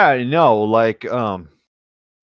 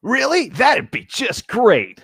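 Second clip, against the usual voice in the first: voicing Streaks; 11 semitones higher, slower than usual. S1 voices Streaks reply.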